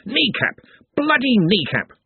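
high, yelping outcry; injured player howling in pain; pained cry. Acted. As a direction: yelp about the kneecap.

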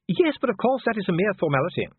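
high-pitched, hurried dismissal; defensive dwarf official. A narrator's reading. Dwarf dismissing doubts about the coronation, defensive. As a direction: brush it off in a high voice.

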